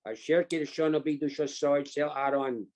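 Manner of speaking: ordinary talk, nothing marked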